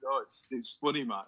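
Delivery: ordinary talk, nothing marked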